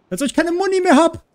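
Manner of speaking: high, loud, exasperated outcry; panicking gamer. Real, not acted. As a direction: yelp it out in disbelief. Runs out of ammo mid-fight. (panisch und schrill)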